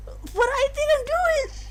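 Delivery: high, pleading voice for the accused; high character voice. Acted. Streamer voicing the accused pleading innocence, high and pleading.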